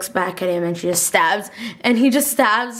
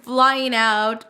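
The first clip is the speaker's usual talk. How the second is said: ordinary talk, nothing marked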